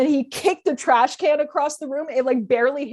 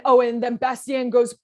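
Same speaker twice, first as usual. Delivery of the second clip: usual speaking voice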